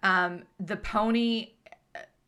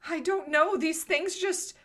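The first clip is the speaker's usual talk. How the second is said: put-on high-pitched, put-upon young lady; airy, higher-pitched dramatic request; overdramatic girl; 8 semitones higher than usual. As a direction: play the wronged girl in a high voice. Game master voices the girl.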